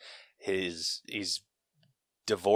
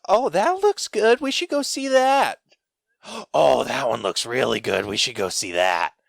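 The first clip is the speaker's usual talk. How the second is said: high, loud gushing imitation; hammed-up excited old Southern lady; 4 semitones higher, 6 dB louder than usual. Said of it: "after every single trailer".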